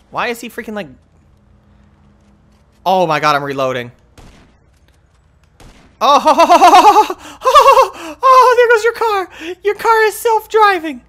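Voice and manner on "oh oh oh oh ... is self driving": high loud laughter through words; laughing. Real, not acted. Laughing at car driving away.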